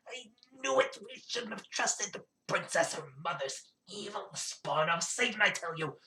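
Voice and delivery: high, excited goblin crying out I knew it; a goblin villager flaring up in alarm; excited goblin outburst. Acted. Villager goblin turning on the princess, in a high excited goblin yelp.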